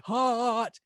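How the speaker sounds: "Hot" as a high, stretched haaaht; drawn-out exclamation. Acted. Teacher acting out something really hot, stretched exclamation. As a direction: cry "Hot" long, as if it really is. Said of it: "unless something is really hot".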